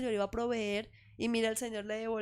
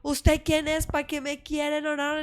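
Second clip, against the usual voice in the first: higher and louder, hostile demands; angry stranger; 5 semitones higher, 7 dB louder, slower than usual. Retold or imitated. Stranger rebuffs the mother on the phone, imitated loud and angry. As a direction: fire questions at her, then cut her off. Suspicious man shouts down mother's call.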